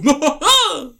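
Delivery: squealing laugh; high burst of laughter. Real, not acted. Laugh after impression.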